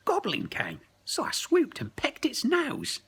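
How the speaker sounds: high, loud squeaky bird voice; seagull voice. Acted. Samalam the seagull reports to the friends, high bird voice. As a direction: talk like an eager cartoon seagull.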